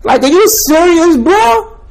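disbelief. Real, not acted. Mocking disbelief at sneaker buyers.

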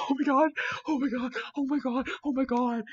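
real squealing fangirl outburst; freaking out. Real, not acted. Reader shocked by the plot twist, shrieking with real excitement. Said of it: "why am I getting so excited".

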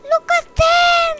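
loud, very high squeal of delight; adoring squeal. Real, not acted. Player adoring the cats on screen, loud high squeal.

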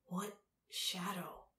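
slow, quiet question. A narrator's reading. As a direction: ask slowly and softly. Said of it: "asked Tal slowly".

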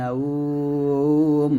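নাওম drawn out very slowly; recited pronunciation. Shown as a demonstration. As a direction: hold নাওম with a long stretched vowel. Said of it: "এরকম". (নাওম টেনে পড়া)

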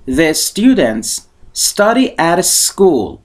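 very slow, every word laid out; slow model reading. Shown as a demonstration. Teacher reading the example slowly for students to repeat, deliberate. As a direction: spell it out slowly for learners to copy. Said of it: "ببینید".

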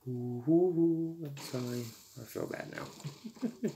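normal talking voice

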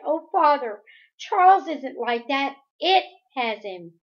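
high, loud distressed plea; crying out. A narrator's reading. Daughter pleads with father about brother, crying out. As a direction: beg your father to believe you. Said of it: "Meg cried".

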